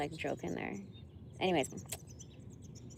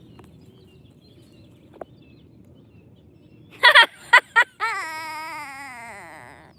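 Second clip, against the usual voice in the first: high, loud burst of real laughter; 8 semitones higher, 10 dB louder than usual. Real, not acted. Reading a funny dating profile, laughing loudly. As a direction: crack up hard.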